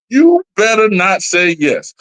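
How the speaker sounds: ordinary talk, nothing marked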